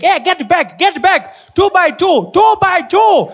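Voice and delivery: very high, loud, frantic calling; shrill-free but frantic teacher yell; panicking teacher. Retold or imitated. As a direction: round up wild kids in a panic. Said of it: "She's panicking, like".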